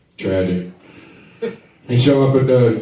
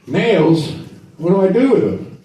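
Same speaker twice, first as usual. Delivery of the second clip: higher-pitched acted puzzlement; mock bewilderment, pitched up; puzzled question; 8 semitones higher than usual. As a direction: ask it as a baffled newcomer to the tool. Puzzled Jesus questioning the Roman.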